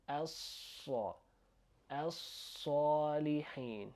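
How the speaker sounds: "As-sa-" with a long s and rounded vowel; slow syllable-by-syllable "as-salihin"; doubled heavy S. Shown as a demonstration. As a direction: hold the s in "as-salihin". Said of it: "it's doubled".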